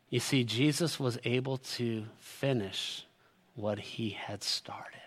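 slow and low, sinking into a whisper; hushed solemn close. Real, not acted. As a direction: bring it down slowly to a reverent hush.

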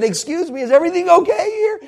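high, loud imitation of a stewardess. Stewardess checking on the arguing passengers, high and loud. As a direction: pitch the question up, loudly checking in.

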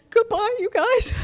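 a sigh, then a high, mournful goodbye; sighing sad goodbye. Retold or imitated. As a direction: mimic the heartbroken farewell lightly. Retelling tearful farewell at church.

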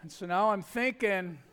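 normal talking voice

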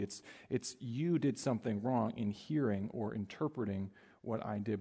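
ordinary delivery

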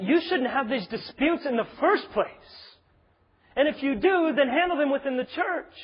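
high, loud, impassioned sermon delivery; forceful preaching. Real, not acted.

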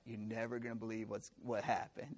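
low, soft mock-confiding voice; understated news-breaking to a pal; teasing friends. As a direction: say it quietly, as if kidding a friend.

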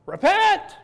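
high, loud street-preacher cry; shouting repent. Retold or imitated. John the Baptist calling the crowd, loud preacher yell.

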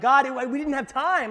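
high, loud mock excuse; making excuses. Preacher mimicking an excuse to God after backing out, high and loud. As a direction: blurt a hammed-up excuse to God. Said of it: "we'll make kinda these excuses".

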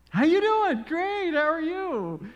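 high, loud, over-friendly hello; hearty greeting. Retold or imitated. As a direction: greet a stranger with exaggerated gusto. Acted exchange of hearty greetings.

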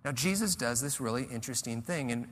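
ordinary talk

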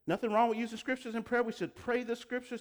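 usual speaking voice

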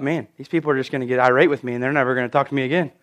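low, quick, soft aside; hurried, subdued fretting; worried inner thought. Retold or imitated. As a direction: think aloud fast under your voice. Retells own worried thought.

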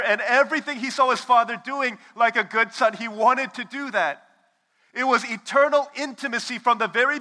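loud, high, impassioned sermon delivery; preaching at full force. Acted. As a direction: preach it hard to the congregation.

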